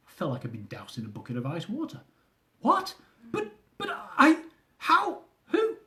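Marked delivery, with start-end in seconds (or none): 2.6-5.8 much higher, stammering shocked outburst; shocked disbelief. Acted. Husband hearing his marriage called fake, stunned and sputtering.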